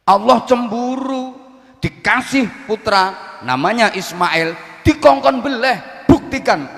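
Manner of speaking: high, loud, heated sermon burst; angry preaching. Real, not acted. Preacher scolds the crowd over the sacrifice story, heated and loud. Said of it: "kudu nesu ngene iki modele ceramahe".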